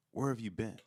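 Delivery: mild accusing question. Retold or imitated. Imitating his girlfriend at the door, mildly accusing. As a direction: ask it like an accusation. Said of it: "It was just that tone".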